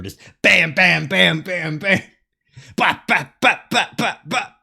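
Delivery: imitating nudges. Imitating dog nosing.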